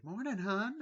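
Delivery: high, sweet mock greeting; lightly voiced cheerful wife; imitating Wendy. Wendy greeting sleeping Jack in a high sweet voice.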